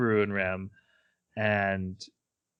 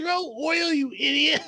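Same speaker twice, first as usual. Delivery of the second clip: retold yell. Otto shouting at Subaru, a high exasperated yell. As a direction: yell it at the fool who forgot the oil. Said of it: "And then Otto's like".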